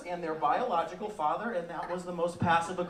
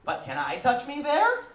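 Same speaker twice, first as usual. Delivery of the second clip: put-on higher, slow child's voice; kid repeating rules; 7 semitones higher, slower than usual. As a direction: recite the rule back like a dutiful little girl. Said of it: "She goes".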